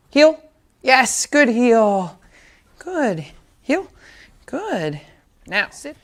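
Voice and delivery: bright trainer voice praising a puppy; dog training voice. Real, not acted. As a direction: cheer the pup on with sharp high commands.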